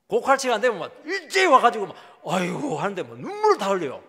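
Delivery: loud hammed-up funeral wailing; mock wailing. Preacher imitating aunts' loud funeral wail.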